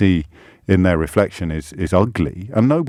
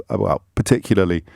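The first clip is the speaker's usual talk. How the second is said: usual speaking voice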